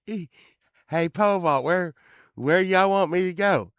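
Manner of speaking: high, loud neighbor calling out; old neighbor's voice. Retold or imitated. Neighbor asking the band where to drive, high loud voice. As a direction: pitch it up as the eager neighbor. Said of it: "he talked like this".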